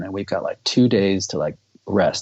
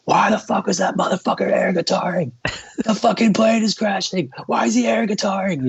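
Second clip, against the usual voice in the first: much higher, frantic imitated rant, starting half-whispered; panicked outburst; 13 semitones higher than usual. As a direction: blurt it out high and hysterical, mocking a terrified friend. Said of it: "he just blurts out".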